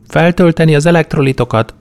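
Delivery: a hint of a practical young woman giving orders; young woman's voice. A narrator's reading. A young woman nursing a hungover man, lightly voiced. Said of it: "mondta a lány".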